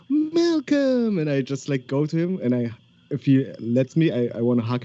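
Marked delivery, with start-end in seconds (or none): 0.1-1.2 high, drawn-out cry of a name; delighted greeting. Acted. Adventurer running to hug Malcolm, delighted greeting. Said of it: "I'm just like".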